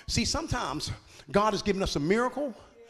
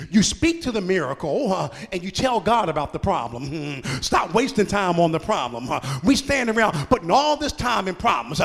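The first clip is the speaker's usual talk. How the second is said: rising preacher cadence, getting louder; preaching; 5 dB louder than usual. Acted. Preacher urging the congregation to stop wasting time, louder. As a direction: press the church to stop dwelling on problems.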